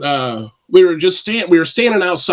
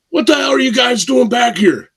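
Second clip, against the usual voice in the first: angry yell; 8 semitones higher than usual. Retold or imitated. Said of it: "this guy's like".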